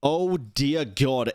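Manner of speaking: normal talking voice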